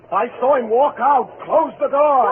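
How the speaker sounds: frightened lover describing the ghost he saw; rising panic. Acted. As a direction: let the terror build to the end. Terrified of dead husband's ghost.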